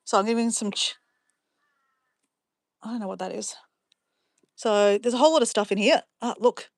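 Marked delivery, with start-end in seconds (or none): 2.8-3.6 whispered to herself; whispering. Real, not acted. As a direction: murmur it under the breath, unvoiced. Unvoiced aside.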